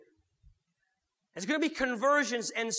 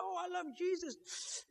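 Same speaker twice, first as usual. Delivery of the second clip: high, soft, sing-song fake piety; mock shallow church talk, up high; 10 semitones higher, 8 dB quieter than usual. Preacher mocking showy Christians, high and soft. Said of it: "See Christians out there going".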